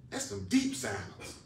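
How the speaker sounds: high, soft marvelling; mock awe. Acting someone discovering deep silence.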